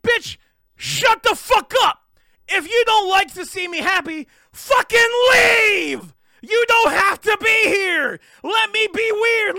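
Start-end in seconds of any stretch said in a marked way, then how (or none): 0.0-2.0 slow, higher and louder snapped-back line; angry retort. Retold or imitated. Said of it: "It's like". 4.5-9.6 louder, higher, slow and cracking; furious shout. Real, not acted. Ranting at people who judge.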